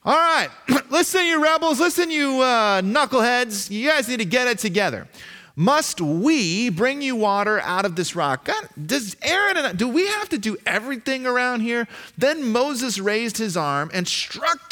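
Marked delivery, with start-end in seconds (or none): 0.0-8.5 high, loud coach-style tirade; exasperated coach scolding the team; halftime coach. Acted. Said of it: "It sounds kinda like a coach at halftime". 8.5-11.9 exasperated. Acted. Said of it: "It sounds kinda like a coach at halftime".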